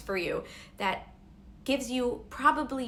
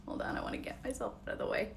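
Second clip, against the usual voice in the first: quiet and shaky; 4 semitones higher, 6 dB quieter, faster than usual. Real, not acted. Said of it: "I'm shaking".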